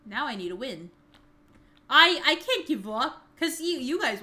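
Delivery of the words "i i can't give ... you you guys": frustrated loud. Real, not acted. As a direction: push the words out loud and fed up. Frustrated at failing.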